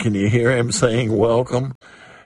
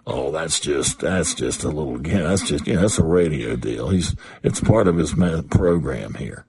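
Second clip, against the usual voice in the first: dismissive doubter; 6 semitones lower than usual. Acted. Preacher mimicking a listener dismissing the call as radio, low and dismissive. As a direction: brush it off like a skeptic.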